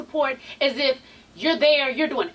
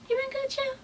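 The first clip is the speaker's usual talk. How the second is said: much higher and softer, a playful mock voice; high put-on voice; 12 semitones higher, 7 dB quieter than usual. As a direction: repeat the greeting high and hushed, teasing. Greeting repeated in put-on voice.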